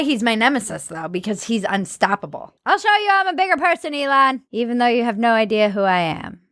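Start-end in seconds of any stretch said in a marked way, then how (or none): none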